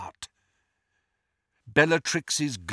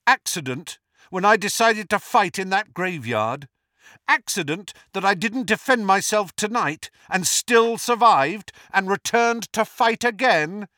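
raised voice, mounting defiance; defiant challenge; 6 semitones higher, 3 dB louder than usual. A narrator's reading. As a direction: build the argument with rising insistence. Harry keeps challenging Voldemort.

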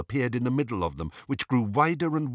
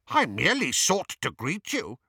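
higher-voiced, stiffly offended courtesy; cool, affronted; 7 semitones higher than usual. A narrator's reading. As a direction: answer a snub with cool formality. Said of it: "said Travers coolly".

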